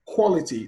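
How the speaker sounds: Quality stressed as QUA-li-ty; stress on first syllable. Shown as a demonstration. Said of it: "on the first syllable".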